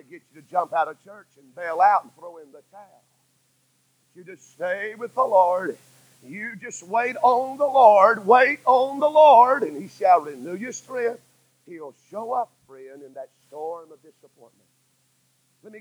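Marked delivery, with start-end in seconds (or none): 6.2-9.6 slow drawn out. Acted. Preacher drawing out the phrase.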